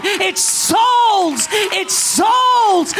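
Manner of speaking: "souls" cried high and long; impassioned cry. Real, not acted. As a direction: cry out "souls" at full preaching force. Preacher's climax on repeated word.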